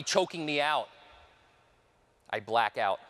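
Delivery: ordinary delivery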